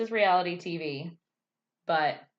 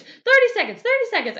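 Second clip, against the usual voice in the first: very high, much louder panicked shriek; frantic yelling; 10 dB louder than usual. Retold or imitated. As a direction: top of the panic, squealing. Elsie yelling numbers.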